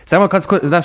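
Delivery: normal talking voice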